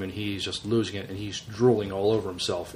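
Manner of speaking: usual speaking voice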